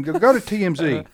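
ordinary delivery

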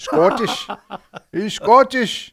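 high, slow hammed-up Scottish lilt; mock Scottish accent. Host teases co-host back in a mock Scottish accent. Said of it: "Say it in this Scottish accent".

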